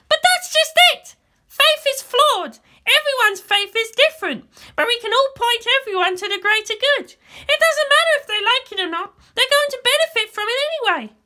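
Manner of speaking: put-on a young girl arguing her point; Asuka's voice. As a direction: raise the voice to play the headstrong girl. Asuka insists, higher and louder.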